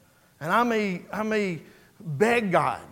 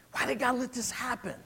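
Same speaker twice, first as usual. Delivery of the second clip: softer, higher, pained question; hushed questioning; 4 semitones higher, 8 dB quieter than usual. Retold or imitated. Preacher voicing niece questioning God, hushed. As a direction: ask it quietly, as a hurt plea.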